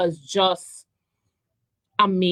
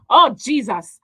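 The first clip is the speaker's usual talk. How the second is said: higher and louder, a sudden thrilled outburst; excited gasp; 5 semitones higher, 7 dB louder than usual. Real, not acted. Fan seeing her childhood crush [name] on stage, thrilled outburst.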